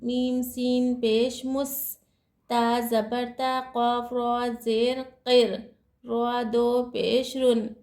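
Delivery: slow, measured letter by letter spelling; slow spelling recitation. Shown as a demonstration. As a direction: spell each letter and vowel carefully. Spells out Quran letters slowly. (धीरे धीरे हिज्जे करके पढ़ना)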